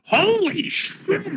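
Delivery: high, drawn-out mock yell; joking high-pitched cry, stretched long; full voice. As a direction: cry out slowly, way up high, as a joke. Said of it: "In just full voice, he goes".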